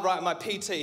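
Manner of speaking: ordinary talk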